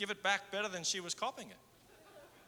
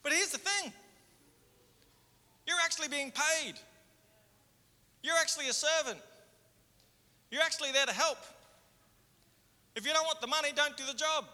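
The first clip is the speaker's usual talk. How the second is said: higher and louder, hammering each point; preaching at full force; 8 semitones higher, 5 dB louder than usual. Acted. Pastor challenging the congregation, preaching at full force. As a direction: drive it home from the pulpit.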